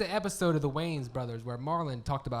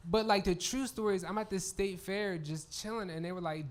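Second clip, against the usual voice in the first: ordinary talk, nothing marked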